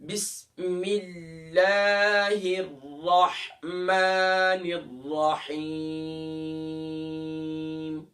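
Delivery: slow, drawn-out melodic recitation; slow Quranic chanting. Shown as a demonstration. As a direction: chant the basmala slowly with tajweed. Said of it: "तो अब दोबारा हम पढ़ते हैं".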